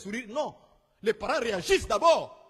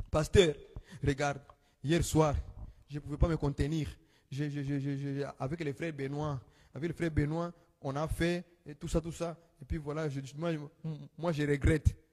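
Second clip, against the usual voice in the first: voicing the sister; 8 semitones lower, 5 dB quieter than usual. Sister confessing, voiced by preacher.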